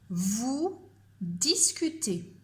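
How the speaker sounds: slow, spaced dictation, each word set apart. Shown as a demonstration. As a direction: read it out for pupils to write down. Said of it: "Je répète une dernière fois".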